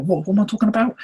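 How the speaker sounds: high, loud, bewildered outburst; baffled at himself. Retold or imitated. Forgetful speaker catching himself mid-thought, high baffled. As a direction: throw it up high like you've lost your thread. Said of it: "sometimes I'm like".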